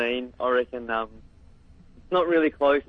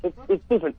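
ordinary talk

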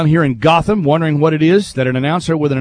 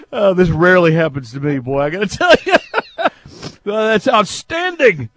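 high, helpless laughing speech; real laughter breaking up the words; laughing to tears; 3 semitones higher than usual. Real, not acted. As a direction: try to talk while cracking up. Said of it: "I'm crying my ass off".

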